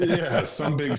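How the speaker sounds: laughing through the words. Real, not acted. Player laughing at himself as a goofball, laughing. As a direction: chuckle the words out.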